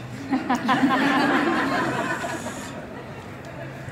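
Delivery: breathy unvoiced burst of laughter; real laugh. Real, not acted. Laughing to the crowd about shoe size, real laugh. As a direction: laugh out at the thought of it.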